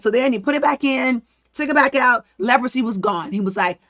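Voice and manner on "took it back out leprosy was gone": normal talking voice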